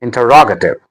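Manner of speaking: "Interrogative" with ROG made prominent; stress on third syllable. Shown as a demonstration. Teacher modelling word stress, "Interrogative" stressed inter-ROG-ative. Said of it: "The stress is on R".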